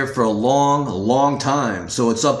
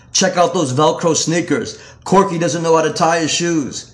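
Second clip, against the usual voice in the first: mocking prep; 6 semitones higher than usual. Preps mocking his sneakers.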